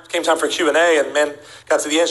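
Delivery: ordinary talk, nothing marked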